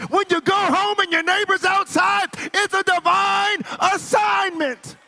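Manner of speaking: loud, high, building to a shout; high-pitched shouted declarations, driven hard; full-force preaching. Real, not acted. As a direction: preach it up to a roaring climax.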